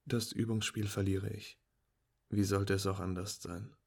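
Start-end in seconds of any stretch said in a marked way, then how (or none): none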